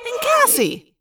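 high, swooping reproach on the name; mock dismay. Marco turns on Cassie, theatrical mock reproach. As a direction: hit the name high, then let it fall.

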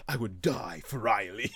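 slow, lightly voiced dreamy heartthrob; a hint of a swooning pop-star boyfriend, slowly; imaginary boyfriend's line. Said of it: "he's like".

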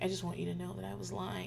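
soft, sheepish admission; quiet confession. Retold or imitated. Son coming back later to confess, quiet. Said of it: "he's like".

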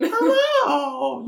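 high squealed greeting; botched female voice. Shown as a demonstration. Player retrying the female voice, high-pitched and going downhill. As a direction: attempt a high female creature voice, badly. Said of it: "No, no, it's, it's gone downhill".

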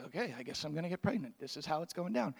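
soft, fast and resigned; resigned murmur. Retold or imitated. Mary accepting the angel's news in a soft, fast, resigned murmur. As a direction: mutter it like a shrugging teen. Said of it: "She's like".

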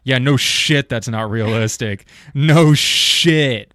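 loud, incredulous scorn; exasperated rant. Real, not acted. Exasperated rant at movie critics.

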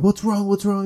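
mock alarmed mother checking on someone; imitated urgent mom. Mom to the screaming woman, urgent concern.

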